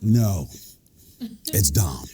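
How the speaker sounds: low, dead-serious correction; stern low reply, pause in the middle; real serious. Acted. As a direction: drop the pitch and shut it down. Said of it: "Get real serious".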